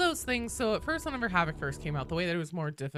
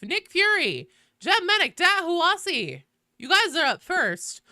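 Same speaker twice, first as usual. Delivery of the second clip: higher, louder announcing of names; upbeat queue call; 11 semitones higher, 8 dB louder than usual. Real, not acted. Streamer reading out who is up first, upbeat.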